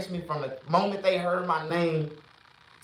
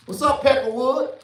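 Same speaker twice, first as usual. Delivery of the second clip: taunting greeting; 7 semitones higher, 6 dB louder than usual. Retold or imitated.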